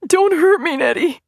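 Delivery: high, frightened squeal; terrified plea in a high squeak. A narrator's reading. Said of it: "he squealed".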